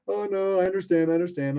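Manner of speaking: high-pitched mock reassurance; high, hurried-to-forgive mimicry; imitating placating staffer. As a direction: put on a high, conciliatory voice for the other guy. Voices staffer accepting his apology.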